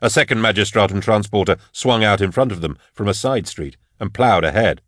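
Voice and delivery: ordinary delivery, nothing marked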